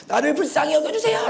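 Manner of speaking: high-pitched acted plea; crying out. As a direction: wail for pity over a hushing crowd. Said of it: "라고 지금 소리를 치고 있습니다".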